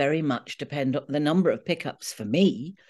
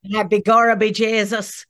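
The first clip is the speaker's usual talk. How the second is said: hammed-up stage Irish accent, a touch louder; a mock over-the-top Irish accent; 3 semitones higher, 6 dB louder than usual. Mocking the cereal mascot in a hammed-up stage Irish accent. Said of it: "That kind of Irish".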